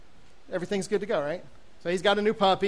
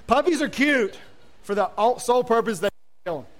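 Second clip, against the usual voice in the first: ordinary delivery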